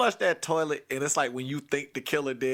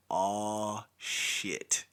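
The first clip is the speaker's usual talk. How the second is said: hushed dread; 6 semitones lower, 7 dB quieter than usual. Retold or imitated. Kids dreading the outcome.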